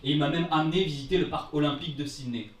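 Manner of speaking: ordinary talk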